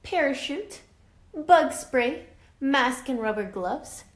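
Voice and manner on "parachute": ordinary delivery, nothing marked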